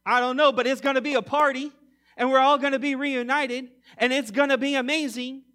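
mock excitement. Mocking a carefree view of heaven.

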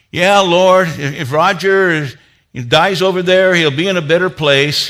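loud, high, earnest praying voice; fervent prayer. Retold or imitated. A church brother praying over Roger, loud and fervent. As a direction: pray it out loud over the traveller.

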